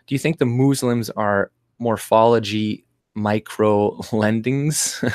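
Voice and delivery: ordinary delivery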